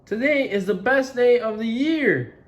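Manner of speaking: high, loud happy pig voice; happy Piggy. Acted. Piggy telling Gerald she is happy, high and bright. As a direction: bubble over with joy.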